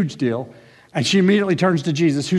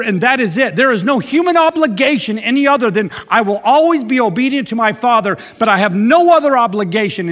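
fervent preaching; 5 semitones higher, 4 dB louder than usual. Acted. Preaching at full force.

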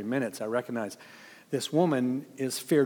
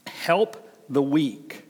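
slow emphatic quote; much slower than usual. Real, not acted. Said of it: "He says".

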